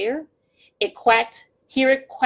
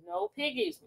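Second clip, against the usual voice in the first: cow voice; 8 semitones higher, 11 dB quieter than usual. Acted. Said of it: "mooed the cow".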